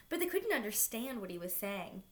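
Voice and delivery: ordinary delivery, nothing marked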